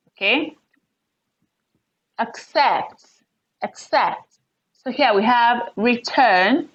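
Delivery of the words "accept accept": acCEPT, light ac, weight on CEPT falling; stress on second syllable. Shown as a demonstration. Teacher giving example verb, CEPT in accept stressed. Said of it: "second syllable".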